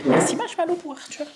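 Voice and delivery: high sweet thank-you; cute voice. Pretend. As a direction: talk to a plush toy. Mother thanks the plush.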